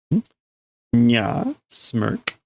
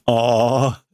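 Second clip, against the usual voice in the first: ordinary talk, nothing marked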